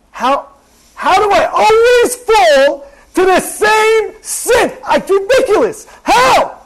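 high, slow, pleading cries of how; a sinner begging himself why; exasperated self-questioning. Acted. Sinner asking himself why he keeps falling, high and anguished.

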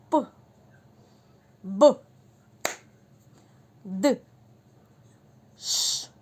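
each letter sound called out loud, one by one; loud sound dictation. Shown as a demonstration. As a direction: call each sound across the room for children to catch. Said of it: "Слухаємо уважно".

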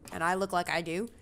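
ordinary delivery

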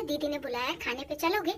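soft, coaxing invitation to the husband; tender wifely coaxing; lovingly. Retold or imitated. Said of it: "मैंने प्यार से बोला".

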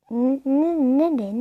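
ordinary talk

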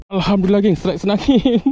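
high, loud burst of joy; elated. Real, not acted. Angler telling the gang of a strike, elated. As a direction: celebrate the catch out loud. Announcing first good catch.

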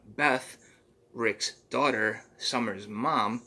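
ordinary delivery, nothing marked

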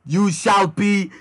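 grand slow proclamation rising to a high held word; theatrical yell. A narrator's reading. Hero passes sentence on Cleric, yelling theatrically. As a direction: stretch "deleted" out in a high yell. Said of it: "I yelled".

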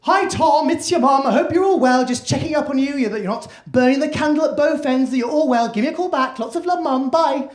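high, lively mum on the answerphone; upbeat mum checking in on her child; chatty mum's voicemail. Retold or imitated. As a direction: rattle off a cheery, high-pitched message. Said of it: "the first one just goes".

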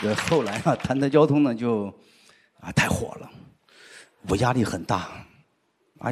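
ordinary talk

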